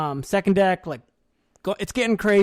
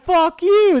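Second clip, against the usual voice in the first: much higher, louder yell back; shouted back; 9 semitones higher, 6 dB louder than usual. Retold or imitated. Rival unit yelling back at them, loud retort. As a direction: fire the insult right back.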